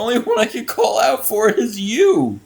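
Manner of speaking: high, loud words through laughter; real fit of laughter; losing it laughing. Real, not acted. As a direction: laugh while getting the words out. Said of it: "I'm fucking losing it".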